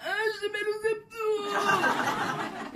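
high, slow, strained cry of pain. Retold or imitated. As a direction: wail about sore abs. Retold pain cry during sex.

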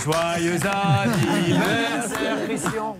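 slow, drawn-out ironic birthday greeting; mock birthday wish. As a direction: stretch it out like a jokey toast. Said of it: "On voulait lui faire la surprise".

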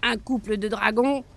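gloating brigand. A narrator's reading. Brigand chief gloating over two dragons, loud and greedy. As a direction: relish the prize, slowing on the riches.

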